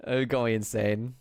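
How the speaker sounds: usual speaking voice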